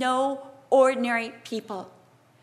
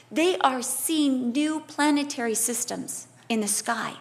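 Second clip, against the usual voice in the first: ordinary talk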